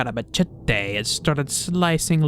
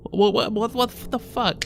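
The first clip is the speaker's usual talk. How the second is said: much higher stammered shock; outraged disbelief; 8 semitones higher than usual. Real, not acted. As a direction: splutter in disbelief. Outraged reaction.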